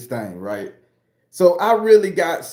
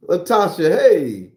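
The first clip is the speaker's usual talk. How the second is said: usual speaking voice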